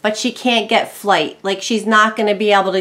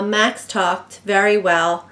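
ordinary talk, nothing marked

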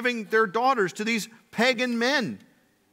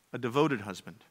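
ordinary talk

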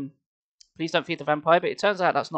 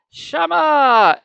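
loud greeting; 11 semitones higher, 10 dB louder than usual. Acted. Greeting with big voice.

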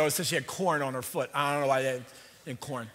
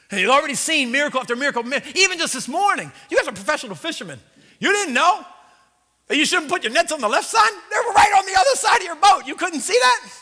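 loud, higher, fast preaching; fiery preaching; 11 semitones higher, 11 dB louder than usual. Real, not acted. Preacher stirs up the congregation at full force. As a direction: drive it home to the crowd.

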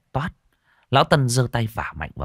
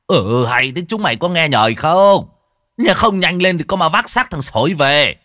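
higher, louder scolding yell; angry scolding; 8 semitones higher, 7 dB louder than usual. A narrator's reading. Old man yelling at villagers to hurry, angry. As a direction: snap at the hesitating crowd. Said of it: "lão Tân quát".

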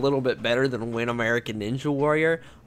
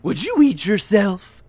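second try at the announcer voice, slower at the end; Harry Carey impression; 4 semitones higher than usual. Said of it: "Will Ferrell impersonating Harry Carey impression".

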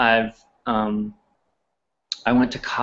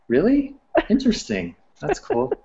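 a high, airy feigned surprise at being called Southern; mock interested reply; 12 semitones higher than usual. To New Yorkers who call him Southern, a high mock-interested reply. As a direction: play politely amused and unconvinced.

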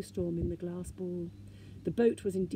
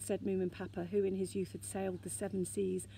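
ordinary delivery, nothing marked

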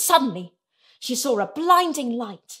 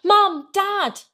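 put-on high, raised child's appeal; pitched-up call; pleading cry; 8 semitones higher, 4 dB louder than usual. A narrator's reading. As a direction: cry out to get both parents' attention. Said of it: "Sarah cried".

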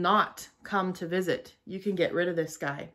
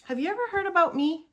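high, fast cartoon germ; cute germ voice; 11 semitones higher, 3 dB louder, faster than usual. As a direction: chirp it like a cute little virus. Virus character asks kids.

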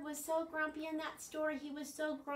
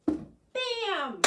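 high, loud punch of a word; sudden loud bam; 9 semitones higher, 8 dB louder than usual. Shown as a demonstration. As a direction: hit it hard like a pan popping. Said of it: "you get the word".

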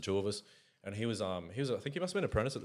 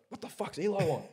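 confused driver; 10 semitones higher than usual. Retold or imitated. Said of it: "he's like".

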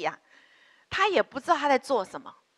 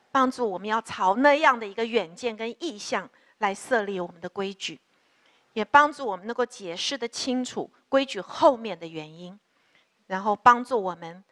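lower, earnest prayer voice addressing God; 4 semitones lower than usual. Acted. Pastor leading congregation in prayer for parents, low earnest prayer. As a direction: pray steadily, a touch lower than the sermon.

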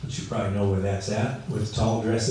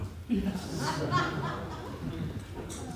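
a long, high, hearty laugh at himself; big laugh; 9 dB quieter than usual. Real, not acted. Laughs at himself mid-sermon.

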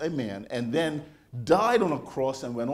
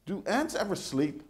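curious wonder; 4 semitones higher, 3 dB quieter, slower than usual. Retold or imitated. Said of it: "And I'm thinking".

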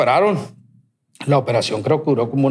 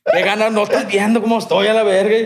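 higher, fed-up griping; exasperated rant; 6 semitones higher than usual. Retold or imitated. Fed up with doctors' questions.

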